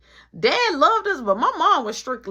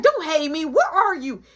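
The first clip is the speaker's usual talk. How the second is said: high, loud mock scolding; scolding mom; 6 semitones higher, 5 dB louder than usual. Mom to son on the phone, acted scolding. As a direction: demand it like a cross mother.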